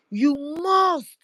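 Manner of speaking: high, loud and insistent; emphatic insistence. Retold or imitated. Host repeating the PM's call to listen, emphatic loud insistence. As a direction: press it home like an order.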